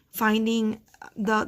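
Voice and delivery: ordinary talk, nothing marked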